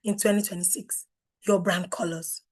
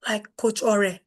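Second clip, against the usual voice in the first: ordinary talk, nothing marked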